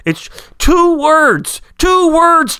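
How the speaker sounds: TWO hit high and long; stress on TWO. Real, not acted. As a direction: stretch out TWO, emphatic. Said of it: "impassioned emphasis".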